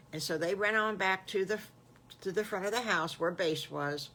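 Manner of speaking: plain reading voice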